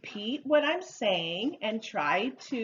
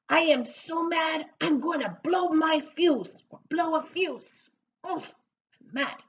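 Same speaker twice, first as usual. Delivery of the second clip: hammed-up, higher-pitched fuming; mock angry; 6 semitones higher, 3 dB louder than usual. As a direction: act out being about to blow up. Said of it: "I'm very angry".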